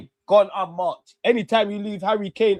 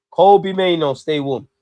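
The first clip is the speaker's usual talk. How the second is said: louder, calling out to the bench; 3 semitones lower, 8 dB louder, slower than usual. Acted. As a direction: call it out so the bench hears. Calling for a sub again.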